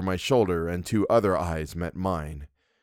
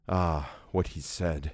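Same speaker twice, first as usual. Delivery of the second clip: slow, softer, lower, as if haunted by the memory; hushed ominous echo; 3 semitones lower, 5 dB quieter, slower than usual. A narrator's reading. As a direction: let the words sink, slowly and quietly. Narrator echoes the words ominously.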